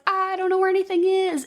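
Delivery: a touch of faked fear; mock panic. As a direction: pitch it up like lost in the dark. Clarice lost in the dark.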